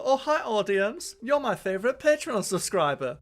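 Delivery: mock the awkward movie greeting; Tommy Wiseau impression. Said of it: "a good Tommy Wiseau impression".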